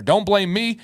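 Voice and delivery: ordinary talk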